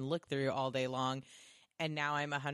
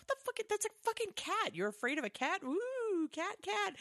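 much higher scoffing, teasing voice; mocking taunt. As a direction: taunt him with the cat. Mocking the scared Skrull.

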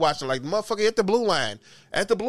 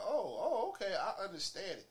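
mock realization; 3 semitones higher, 15 dB quieter than usual. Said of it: "I'm like".

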